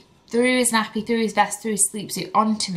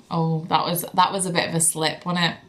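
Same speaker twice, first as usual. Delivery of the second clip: ordinary talk, nothing marked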